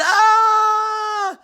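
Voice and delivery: high sharp yelp of pain; pained cry. Acted. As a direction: cry out as if hurt.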